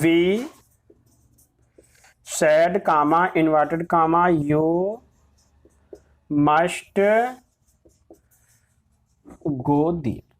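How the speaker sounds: drawn-out words with long gaps; slow dictation. Shown as a demonstration. Teacher dictating an example sentence, slow dictation. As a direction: dictate it word by word, stretching each.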